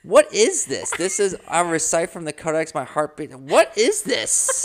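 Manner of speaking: mock-horrified squeal; indignant disbelief. Real, not acted. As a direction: demand an answer in outrage. Mock indignant over text.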